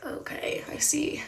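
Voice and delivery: near-whispered, retreating acknowledgement; hushed backing off. Retold or imitated. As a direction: whisper it as if meekly stepping away. Retold backing off after being rebuffed.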